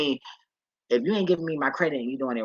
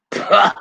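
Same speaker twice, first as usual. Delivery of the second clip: loud, high burst of a laugh; short laugh; 7 semitones higher, 12 dB louder than usual. Real, not acted. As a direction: laugh out suddenly at the coincidence. Laughs on spotting eleven ten.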